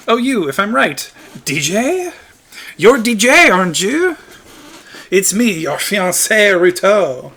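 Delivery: put-on high French-accented princess greeting her fiancé; French-accented Ruto. Ruto greeting her long-lost fiancé, French-accented and high. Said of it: "I suddenly made Ruto French".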